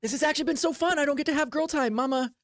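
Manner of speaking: put-on a young performer gushing about girl time; chatty circus girl. Circus girl gushing to new friend, lightly voiced.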